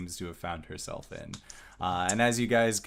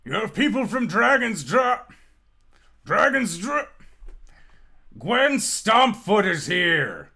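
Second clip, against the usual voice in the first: loud high call up the stairs, stumbling over the name; calling upstairs; 13 semitones higher, 8 dB louder than usual. Acted. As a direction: yell up to the boss, much higher and louder. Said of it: "puts his hand up to his mouth and says".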